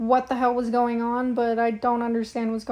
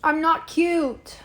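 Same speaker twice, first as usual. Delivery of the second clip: slow, higher-pitched protest; indignant protest; 4 semitones higher, 3 dB louder, much slower than usual. Real, not acted. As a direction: push back, drawing the words out slowly. Protesting being called cute.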